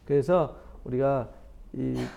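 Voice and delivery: ordinary talk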